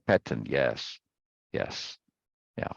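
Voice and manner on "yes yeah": ordinary delivery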